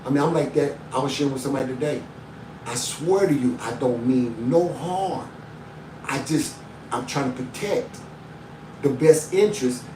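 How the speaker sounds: ordinary delivery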